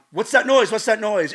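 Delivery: retold alarm, urgently repeated; alarmed mom. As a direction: imitate a mother calling out in alarm. Acting out alarmed mom arriving.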